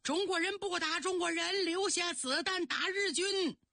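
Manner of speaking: high, sustained battlefield slogan call. A narrator's reading. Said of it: "上边还喊呐". (高声向敌阵喊话)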